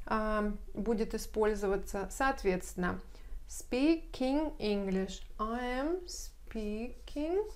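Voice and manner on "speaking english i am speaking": slow, careful English dictation; teacher's slow demo; slow model reading. Shown as a demonstration. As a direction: spell it out slowly for the class. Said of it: "teacher models English slowly".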